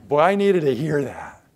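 grateful person thanking the one who helped; grateful exclaim. Shown as a demonstration. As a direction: say it like a real relief. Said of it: "I don't like when they say".

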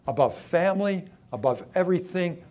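forceful demand. Acted. Jesus' demands voiced in sermon.

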